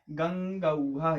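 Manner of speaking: गंगौघः drawn out slowly, syllable by syllable; slow model word. Shown as a demonstration. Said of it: "गंगौघः (slow model form)". (धीरे-धीरे उच्चारण)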